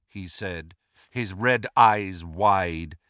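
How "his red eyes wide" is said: ordinary delivery, nothing marked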